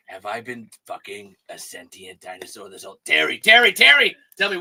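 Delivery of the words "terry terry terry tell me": loud. Pretend. Character mock interrogation.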